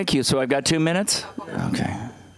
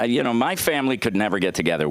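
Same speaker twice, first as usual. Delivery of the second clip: ordinary talk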